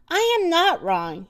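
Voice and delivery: mild indignant shout. A narrator's reading. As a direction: protest crossly without full volume. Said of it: "Eugenia shouted".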